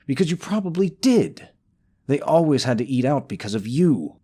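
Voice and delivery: loud, high, heated rebuttal; heated accusation. Acted. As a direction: snap back at the rival. Accusing rival, raising voice.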